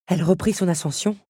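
ordinary delivery

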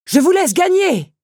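high, loud shout across a distance; shouted playful claim; calling out. A narrator's reading. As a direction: holler it teasingly after a runner. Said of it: "l'interpella la bête".

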